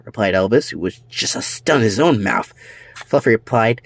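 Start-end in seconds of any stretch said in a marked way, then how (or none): none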